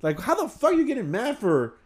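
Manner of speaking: baffled, drawn-out and climbing high in pitch; incredulous retelling. Retold or imitated. Telling friends about a co-worker mad over a butt dial, incredulous. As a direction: stretch it out in high-pitched disbelief.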